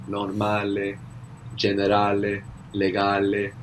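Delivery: "Normale, generale, legale" each ending in a clear eh; Italian vowels, sounded final E in "Normale, generale, legale". Shown as a demonstration. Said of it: "We're adding on to the end of the words".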